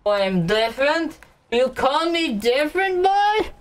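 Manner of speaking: indignant. Real, not acted. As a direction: act outraged, voice climbing. Drunk indignant rising protest.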